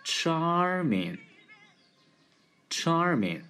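"charming" very slow with wide-mouthed curled R; model pronunciation. Shown as a demonstration. Teacher demonstrating the word for charming, slow model pronunciation. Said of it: "一个破擦辅音加一个啊大嘴卷舌音".

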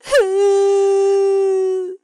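high, long acted child's wail; loud wailing cry. A narrator's reading. Boy cries because he can't take his blanket, wailing. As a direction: bawl loudly on one long high note. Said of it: "哭个不停".

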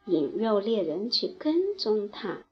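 plain reading voice

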